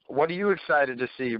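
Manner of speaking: ordinary talk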